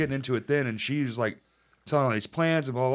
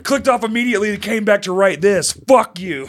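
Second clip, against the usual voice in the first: loud, raised-pitch comic indignation; mock angry rant; 5 semitones higher, 8 dB louder than usual. Podcaster imagines the commenter returning to insult, loud mock anger. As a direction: punch it out in mock outrage.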